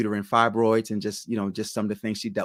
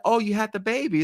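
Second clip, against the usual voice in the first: higher-pitched retold exclamation; lightly acted higher-voiced surprise; surprised nurse; 11 semitones higher than usual. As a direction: imitate a pleasantly surprised acquaintance. Retelling nurse's surprised question.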